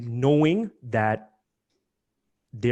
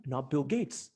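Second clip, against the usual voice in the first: usual speaking voice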